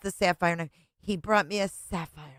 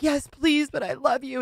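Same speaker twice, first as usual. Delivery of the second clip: mock begging ex; 7 semitones higher than usual. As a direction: plead like a needy ex. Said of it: "and he was like".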